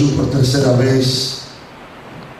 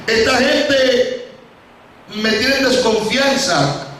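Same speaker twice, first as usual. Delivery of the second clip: high-pitched, anguished complaint, long drawn phrases; acted lament, climbing in pitch; tearful pleading; 9 semitones higher than usual. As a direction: plead it out, hurt and building higher. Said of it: "comenzó a llorar y dice".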